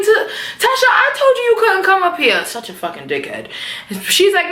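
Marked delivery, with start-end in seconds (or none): 0.0-2.4 high, mock-exasperated Ghost voice; hammed-up whiny man; imitating Ghost. Ghost scolding Tasha at the elevator, high mock whine. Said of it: "He like".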